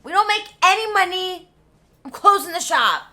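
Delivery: loud, high exasperated announcement; fed-up outburst. Retold or imitated. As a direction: threaten to close the shop in a huff. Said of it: "Mister Magushnik is like".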